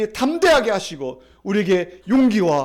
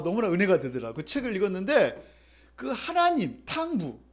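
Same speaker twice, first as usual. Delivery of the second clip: usual speaking voice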